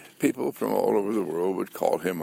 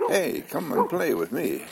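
lightly voiced eager dog, higher; a friendly pup calling out, a bit higher; playful dog voice; 7 semitones higher than usual. Dog Rex calls another dog to play, lightly voiced high.

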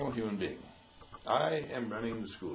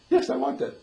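high, loud imitation of a keen yes; mock-eager, much higher and louder; eager agreement; 11 semitones higher, 7 dB louder than usual. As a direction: pipe up keenly as the listener. Acted listener eagerly agreeing.

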